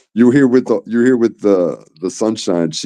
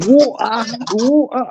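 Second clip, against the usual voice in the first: much higher mock woman's whoa, ah; imitating British woman. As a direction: parody the lady he loved, pitched way up. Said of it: "my favorite part is".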